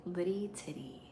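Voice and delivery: playful whispered nickname. Acted.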